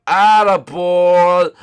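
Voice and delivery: loud, high, stretched-out shout; triumphant cheer. Real, not acted. Gamer praising his character's jump, drawn-out cheer. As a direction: cheer it out, drawing out each syllable.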